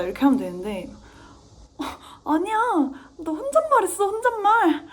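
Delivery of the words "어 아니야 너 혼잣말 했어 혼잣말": much higher, louder mock mom; mom scolding. Mom catching her child talking to herself, exasperated high mock scold. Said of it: "이래가지고".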